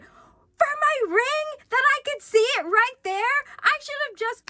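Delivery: high-pitched, loud outraged wail; heated. Real, not acted. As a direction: cry out in exasperated disbelief. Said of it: "oh my god, I'm getting heated".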